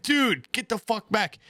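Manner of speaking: acted-out plea to back off; exasperated, pitch jumping up; retold exasperation.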